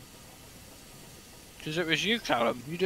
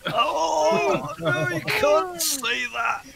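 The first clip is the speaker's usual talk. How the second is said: mock shocked; 12 semitones higher, much slower than usual. Mock outrage at joke.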